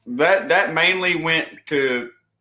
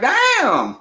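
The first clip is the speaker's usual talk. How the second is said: high, mock-impressed damn; a little louder, impressed; 12 semitones higher, 5 dB louder than usual. Co-host reacting to the rant, impressed. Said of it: "I was like".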